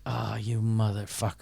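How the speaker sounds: low, soft, weary curse; exasperated low aside; muttered exasperation. Retold or imitated. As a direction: curse them under your breath. Reacts to fire alarm prank.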